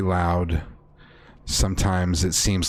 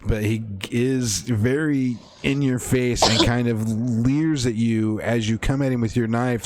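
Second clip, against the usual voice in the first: ordinary talk, nothing marked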